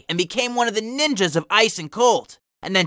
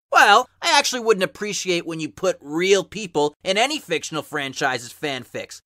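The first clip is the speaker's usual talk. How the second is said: usual speaking voice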